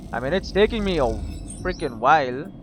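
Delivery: normal talking voice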